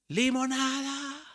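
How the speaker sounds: put-on high, drawn-out little girl's street cry; child calling out. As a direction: call it out like a small girl selling lemonade. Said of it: "mi hija empezó a gritar". (niña pregonando limonada)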